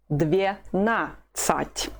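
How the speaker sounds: Dvenasat very slowly, split as dve-na-sat; syllable by syllable. Shown as a demonstration. Teacher models twelve for learners, Dvenasat slowly in separate syllables. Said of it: "The stress is on the second syllable".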